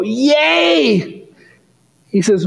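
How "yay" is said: high, delighted mock cheer; gleeful yay. Preacher recalls cheering at the dad's answer, high and gleeful. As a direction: cheer like you've already won. Preacher retells his own joy.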